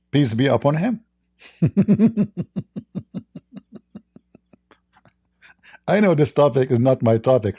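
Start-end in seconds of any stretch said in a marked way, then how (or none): 1.4-5.1 high amused laugh. Real, not acted. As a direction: laugh out at your own joke. Laughs at own joke.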